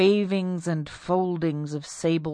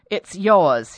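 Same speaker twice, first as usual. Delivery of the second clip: blunt husband; 10 dB louder than usual. Acted. Husband accuses.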